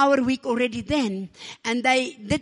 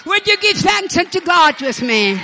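rallying call; 5 semitones higher, 6 dB louder than usual. Real, not acted. Preacher calls for praise.

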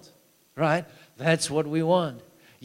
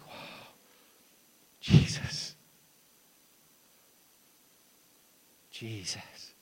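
awed whisper; 5 semitones lower, 4 dB quieter, much slower than usual. Retold or imitated. Retelling awe at healed hand.

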